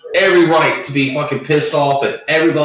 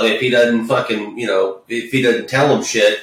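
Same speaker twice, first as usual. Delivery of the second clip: ordinary talk, nothing marked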